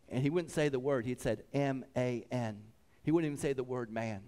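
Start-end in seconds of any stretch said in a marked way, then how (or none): 1.5-2.6 "M A N" as separate letters, slow and low; retold deliberate spelling of "M A N"; spelled out. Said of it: "he spelled it out".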